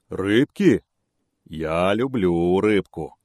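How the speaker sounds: slow, relishing the word; lip-licking bear. A narrator's reading. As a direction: savour it like a hungry bear. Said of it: "облизнулся медведь". (медведь облизываясь, смакуя)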